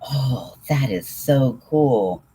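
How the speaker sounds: slow, drawn-out delighted gush; excited gush. Real, not acted. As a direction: gasp in real delight at the idea. Reacting to viewer's yarn gift.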